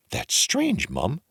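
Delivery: squeaky high mock surprise; feigned surprise. A narrator's reading. Jack playing innocent to the wife, very high. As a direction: act innocent. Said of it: "said Jack".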